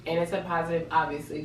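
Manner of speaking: normal talking voice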